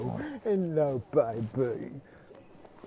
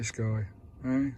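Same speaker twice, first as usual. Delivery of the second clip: usual speaking voice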